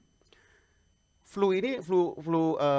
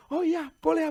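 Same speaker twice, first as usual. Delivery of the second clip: high, louder eager agreement; friend agreeing; 7 dB louder than usual. Retold or imitated. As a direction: chirp the friend's happy yes.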